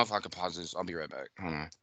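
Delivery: low and very soft, withdrawing; real shaken, subdued. Real, not acted. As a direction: quietly ask for a moment to take it in. Shaken by news, asking to pause.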